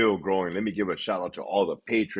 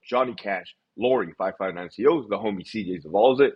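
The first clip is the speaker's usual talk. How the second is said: normal talking voice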